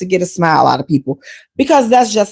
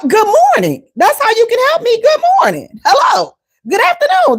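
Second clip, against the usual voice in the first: much higher, sing-song greetings; exaggerated greeting; 12 semitones higher than usual. Shown as a demonstration. Customer showing baristas the greeting she wants, high and sing-song. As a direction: overdo the cheerful hello. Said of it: "demonstrating the wanted greeting".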